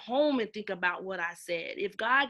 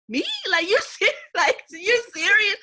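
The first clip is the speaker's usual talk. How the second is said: mock disbelief; 8 semitones higher, 9 dB louder than usual. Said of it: "And I'm on the other end like".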